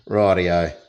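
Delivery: usual speaking voice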